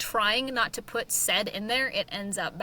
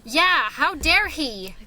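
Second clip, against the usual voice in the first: slow, higher, louder hammed-up indignation; mock outrage; 6 semitones higher, 8 dB louder, much slower than usual. Host fakes shock at the brother turning into a chair, with hammed-up outrage. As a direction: pretend to be scandalized by the brother.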